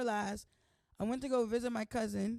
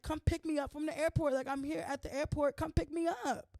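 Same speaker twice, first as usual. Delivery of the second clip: excited plea; 6 semitones higher than usual. Retold or imitated. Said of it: "I'm like".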